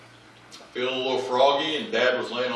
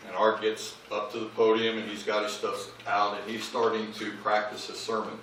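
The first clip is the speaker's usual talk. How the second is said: ordinary delivery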